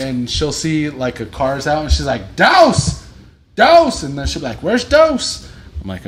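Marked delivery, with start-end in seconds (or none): 2.3-4.0 very high, loud repeated holler; imitated scream. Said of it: "she screams it all the time". 4.6-5.5 high, loud demanding question; imitated scream. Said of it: "she screams it all the time".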